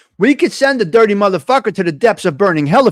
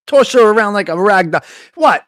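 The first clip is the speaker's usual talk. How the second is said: normal talking voice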